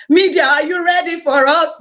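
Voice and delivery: ordinary talk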